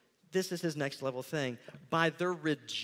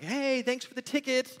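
high-pitched, bubbly acted gratitude; upbeat gloating colleague, pitched up; cheery thanks; 9 semitones higher, 4 dB louder than usual. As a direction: call out a gleeful thank-you. Acted happy colleague on social media.

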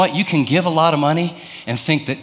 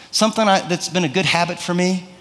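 ordinary delivery

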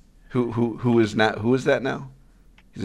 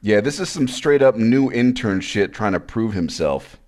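normal talking voice

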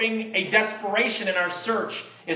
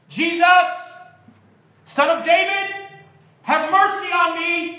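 high, loud cry for mercy; desperate shout; 11 semitones higher, 6 dB louder than usual. Acted. Blind beggar calls out to Jesus passing by, shouting. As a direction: call out loud across a crowd, pitch way up. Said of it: "he began to shout".

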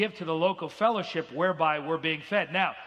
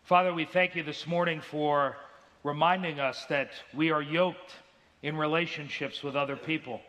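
lightly voiced closing prayer; pastoral prayer. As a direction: pray over the congregation, unhurried.

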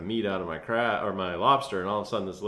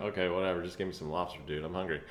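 1 muttered aside; 5 semitones lower, 7 dB quieter, faster than usual. Retold or imitated. Brushing off the captain, hungry.

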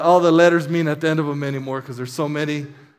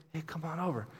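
quietly saying; 17 dB quieter, faster than usual. Shown as a demonstration. Preacher showing how Jesus did not speak, quiet casual invite. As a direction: say it quietly, almost under your breath. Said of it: "It's not quietly saying".

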